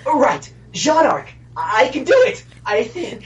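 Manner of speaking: put-on nervous naive young voice; Jean voice. Shown as a demonstration. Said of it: "That's all you get with the Jean voice".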